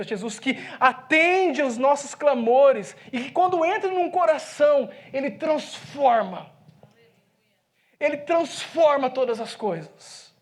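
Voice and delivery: slow, rising emphatic preaching; preaching build. Acted. Preacher proclaiming to the congregation what Jesus does, slow and emphatic. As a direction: drive each phrase home slowly.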